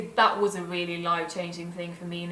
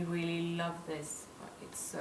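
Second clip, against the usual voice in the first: whispered; 2 semitones lower, 9 dB quieter, slower than usual. Real, not acted. Whispered aside.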